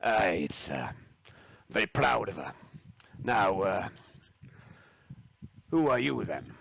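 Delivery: proud Scottish captain, a touch gruff; Scottish accent. Acted. The captain asks the newcomers who they are, light Scottish accent. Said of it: "Scottish accent".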